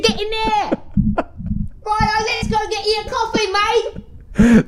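high-pitched hammed-up English accent; put-on English accent. Shown as a demonstration. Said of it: "when I use that voice".

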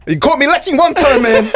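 high-pitched embarrassed plea breaking into laughter; laughing protest. Real, not acted. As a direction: protest your one slip while cracking up.

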